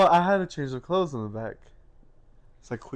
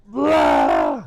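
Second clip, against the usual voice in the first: loud mock retch; retching sound; 11 dB louder than usual. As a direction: fake vomiting noise. Mimicking throwing up out door.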